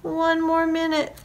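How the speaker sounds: high-pitched plea from bed; pleading farmer. A narrator's reading. Farmer bargaining to stay in bed, pleading.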